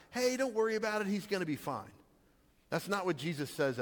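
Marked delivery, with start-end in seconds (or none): 0.1-1.9 higher, breezy brush-off; casual reassurance. Retold or imitated. Pastor mimicking what Jesus did not mean, breezily dismissive. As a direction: toss it off as no big deal. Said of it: "the emphasis is not".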